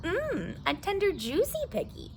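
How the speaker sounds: licking his lips over a juicy meal; hungry coyote. Acted. Coyote gloats over prey.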